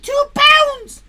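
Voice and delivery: two pounds shouted high and loud; mock-excited "Two pounds"; loud high emphasis. Shown as a demonstration. Reading the prize to chat, loud high exclamation.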